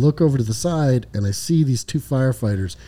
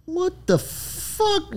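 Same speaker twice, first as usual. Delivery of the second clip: much higher, slow what-the-fuck; incredulous squeal; 4 dB quieter, much slower than usual. Retold or imitated. Lieutenant hit with a second disaster, high disbelieving squeal. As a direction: stretch it up in outraged disbelief. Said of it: "So now I'm going".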